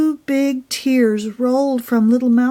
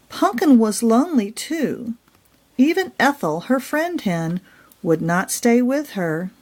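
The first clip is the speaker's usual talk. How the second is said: plain reading voice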